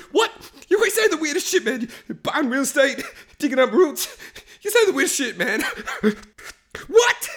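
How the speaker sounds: high, hammed-up hysterical babble; squeaky-high mock panic; freaking out. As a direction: blurt defensively in a comic meltdown. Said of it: "It's him freaking out".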